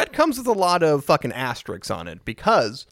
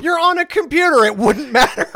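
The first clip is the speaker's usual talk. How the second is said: high, loud laughing retort; loud mock outrage; 13 semitones higher, 7 dB louder than usual. Real, not acted. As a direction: shout it way up high through laughter. Laughing retort to co-host's excuse.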